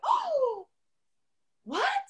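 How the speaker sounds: very high, soft shocked gasp of words; stunned surprise. Real, not acted. Author stunned by her character's reveal, very high and soft. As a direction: caught totally off guard. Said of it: "And I went".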